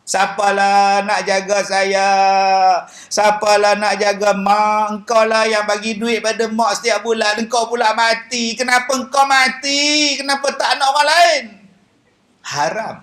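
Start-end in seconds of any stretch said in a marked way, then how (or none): 0.1-11.4 wailing lament. Retold or imitated. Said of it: "Lagi mengadu nasib".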